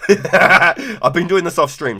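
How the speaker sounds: slow, loud, laughing through it; giggling confession. Real, not acted. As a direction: laugh the words out.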